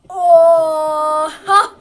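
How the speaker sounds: long loud shocked oh; loud gasp. Real, not acted. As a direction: let out a big startled oh. Reacting in game.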